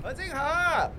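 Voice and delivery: high call from a distance. Acted. Man spots his girlfriend and calls her name from afar.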